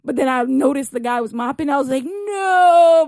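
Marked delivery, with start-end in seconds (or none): 2.0-3.1 loud panicked no. Retold or imitated. Slipping on mopped floor.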